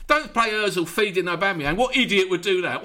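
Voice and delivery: usual speaking voice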